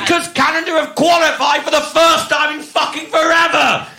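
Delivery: loud, high, fed-up yell; exasperated shout. Real, not acted. To a co-host who should know, a loud exasperated shout. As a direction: blast it out in disbelief.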